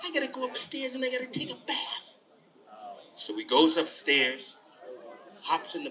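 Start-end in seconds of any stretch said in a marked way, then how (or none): 0.0-2.2 put-on higher, softer boy's voice; a hint of a worried kid; lightly voiced little boy. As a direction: say it small and high, as young Tommy. Storyteller voices Tommy's line.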